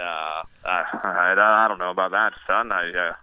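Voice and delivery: put-on lightly done transatlantic accent, slow and low; a hint of old Hollywood transatlantic drawl; Cary Grant voice. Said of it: "do a little Cary Grant for us".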